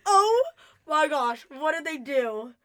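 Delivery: loud, high mock shock, dropping off; drawn-out dramatic alarm, hammed up; retold freaking out. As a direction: re-enact a freaked-out reaction. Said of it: "I was freaking out".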